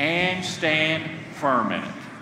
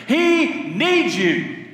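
tender; 7 semitones higher, much slower than usual. Acted. Tender emphatic repetition.

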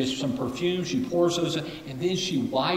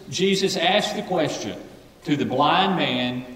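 ordinary delivery

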